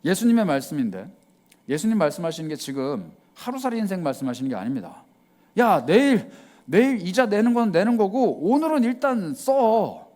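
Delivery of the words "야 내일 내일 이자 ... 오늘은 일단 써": high, loud careless voice; a loud, reckless "live for today" voice; carefree spender. Retold or imitated. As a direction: brush off tomorrow, spend today. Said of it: "이 말씀이 아니라는 거예요".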